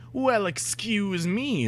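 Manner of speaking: indignant excuse me. Acted. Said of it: "they both shouted angrily".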